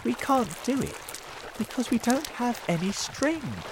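high, regretful voice; said sadly. A narrator's reading. James giving up his plan for lack of string, said sadly. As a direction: explain with mild disappointment. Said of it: "James said sadly".

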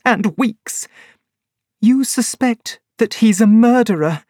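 high, bitter woman's voice; a betrayed woman confessing; high voice of a woman betrayed by her lover; Laura Lyons. A narrator's reading.

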